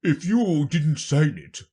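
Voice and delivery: weighty royal reasoning; accusing king. Acted. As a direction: bear down as a storybook king. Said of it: "said the king".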